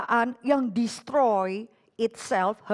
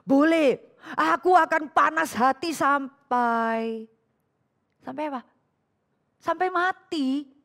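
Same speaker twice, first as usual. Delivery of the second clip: Jonah defiant; 4 semitones higher, slower than usual. Acted. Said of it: "dia jawab apa".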